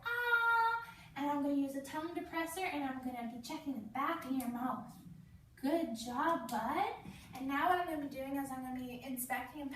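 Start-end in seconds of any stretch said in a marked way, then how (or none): 0.1-0.8 put-on much higher, loud open "Ah!" for the child to copy; wide-mouthed demo "Ah!"; big "Ah". Shown as a demonstration. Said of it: "I want you to make a big bug go". 1.2-6.9 put-on lightly sing-song voice for a small child; playful nurse voice for a little boy; talking to a child. Nurse talks to child patient.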